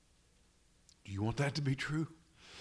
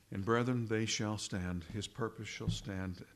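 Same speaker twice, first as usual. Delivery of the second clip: ordinary delivery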